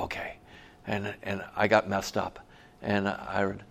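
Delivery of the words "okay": whispered, timid acceptance; meek okay. Retold or imitated. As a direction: give in under your breath.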